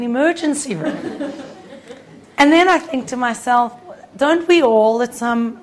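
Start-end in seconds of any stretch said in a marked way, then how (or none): none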